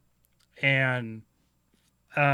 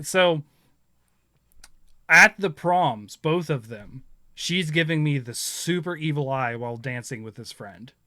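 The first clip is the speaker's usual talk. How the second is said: ordinary talk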